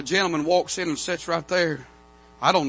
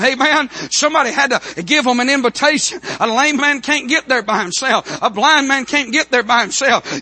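driving, raised-voice revival sermon; fired-up preaching; 6 semitones higher, 7 dB louder than usual. Acted. Preacher urging church to go get sinners, fired-up preaching. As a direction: press each phrase home to the congregation.

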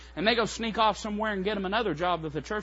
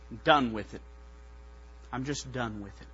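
low, resigned, acted surrender; weary quitting; 6 semitones lower, 6 dB quieter than usual. Preacher as Jeremiah telling God he quits, weary and low. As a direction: drop low, as if worn out and quitting.

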